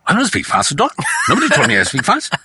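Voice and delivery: imitating a brother's rapid-fire protest; speaking too fast. Brother denying to father he talks fast, speaking too fast. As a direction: rattle off a defensive denial, words tumbling. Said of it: "even that you're speaking fast".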